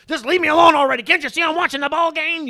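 loud, high mock outburst; irritated parent. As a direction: play a parent yelling at the kids to go away. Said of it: "you hear people saying to their kids".

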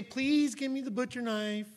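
gentle pushover parent asking; mock meek request. Shown as a demonstration. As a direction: ask too nicely, slowly. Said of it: "you wouldn't say".

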